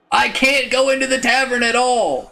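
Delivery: high, loud exasperated cry; real frustration at the game; frustrated outburst. Real, not acted. Player complains the game won't let him into the tavern, exasperated.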